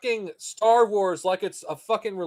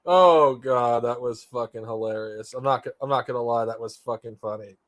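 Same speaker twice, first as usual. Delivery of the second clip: ordinary delivery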